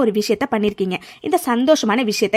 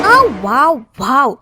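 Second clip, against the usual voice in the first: gleeful wow; 5 dB louder, much slower than usual. Acted. Gloating villain hears good news.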